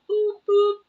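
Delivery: loud high squeaky oops. Pretend. Reader mimicking someone blurting, loud squeaky oops. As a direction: yelp out a silly oop oop.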